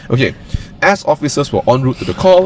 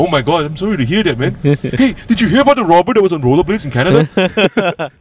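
mock Canadian cop; 6 semitones higher than usual. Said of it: "And then Canada comes over. It's like".

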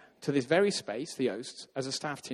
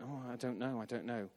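unsure mumble; 2 semitones lower, 11 dB quieter than usual. Retold or imitated. Group doubts their faith.

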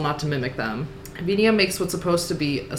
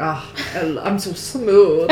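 slow, louder self-satisfied sigh; smug slow purr; 5 dB louder, slower than usual. Retold or imitated. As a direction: purr it out smugly and slowly. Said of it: "You're like".

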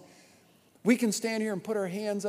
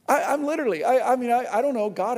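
loud, higher, stumbling for words; overwhelmed excitement; 6 semitones higher, 7 dB louder, faster than usual. Real, not acted. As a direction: gush it, barely able to finish a thought. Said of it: "am I being humble?".

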